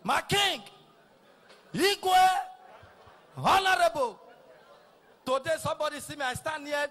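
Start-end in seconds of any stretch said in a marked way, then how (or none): 0.0-4.2 loud high praise-calls; hailing titles. Retold or imitated. Crowd hailing a big man at the wedding, loud calls. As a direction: hail him like a big man arriving. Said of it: "hailing that they owe you".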